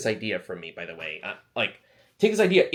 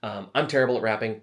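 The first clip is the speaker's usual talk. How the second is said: usual speaking voice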